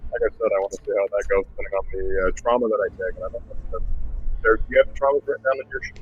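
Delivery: muffled, garbled mic; like talking underwater. Real, not acted. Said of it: "it sounds like you're underwater".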